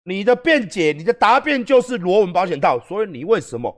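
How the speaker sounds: the judge demands to know why the condom is missing, angrily; angry judge. Retold or imitated. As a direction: play the judge losing patience, scolding. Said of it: "那法官又生气啦".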